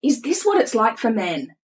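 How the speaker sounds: high-pitched, amazed demand; retold astonished outburst; incredulous questioning. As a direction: press him in comic disbelief, pitch climbing. Acted incredulous questions to husband.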